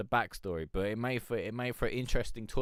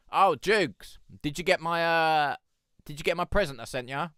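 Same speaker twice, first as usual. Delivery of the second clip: louder, higher call to a friend; 8 semitones higher, 8 dB louder than usual. Real, not acted. Calling out to Jukes about his present, louder and higher. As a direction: call out to Jukes across the stream.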